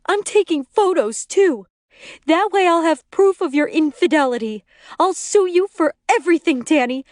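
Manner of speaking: vengeful threat. A narrator's reading. Glaring wife threatens husband before leaving.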